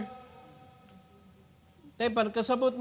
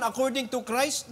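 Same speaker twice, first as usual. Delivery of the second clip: normal talking voice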